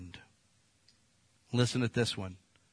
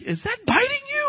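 much higher voice for the wife; wife's question; 4 dB louder than usual. Retold or imitated. Preacher voicing his wife asking about the snake, high startled voice. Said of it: "She's like".

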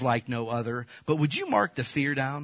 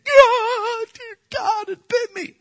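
much higher, loud startled cry of pain; panicked yelp; 9 dB louder, slower than usual. Retold or imitated. Preacher reliving the snake bite, high panicked yelp. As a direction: yelp like the snake just bit you.

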